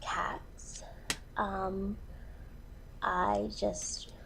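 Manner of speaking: choked up, near-whisper; getting emotional. Acted. Woman introducing herself on dating video, overcome by cats, emotional. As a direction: voice sinks away, overcome. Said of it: "sorry, I'm getting emotional".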